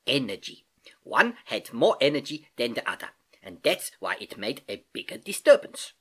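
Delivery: put-on accented uncle teaching, key words lifted; patient Uncle Albert, explaining. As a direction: explain it like an eager old professor uncle.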